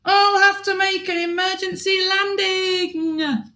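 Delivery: high, bright princess character voice; a lightly worried princess calling out. Acted. Princess in her spaceship, worried about landing, high princess voice.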